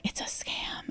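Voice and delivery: whispered aside, very soft; imitated whisper. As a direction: whisper a mock warning under your breath. Retelling partner's aside behind caller's back.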